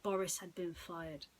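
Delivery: ordinary delivery, nothing marked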